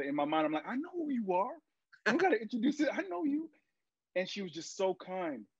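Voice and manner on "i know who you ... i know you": starstruck inner voice. Retold or imitated. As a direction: voice jumps way higher, giddy and breathless with disbelief. Inner thought on meeting idol.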